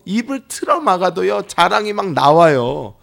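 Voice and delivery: full-force pulpit crescendo; fervent preaching. Acted. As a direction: preach it with rising fire. Preaching climax.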